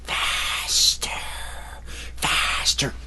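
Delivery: hushed, breathy skeleton command; ghostly command. Acted. Skeleton whispering a spell over the sofa. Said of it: "looked into space and said".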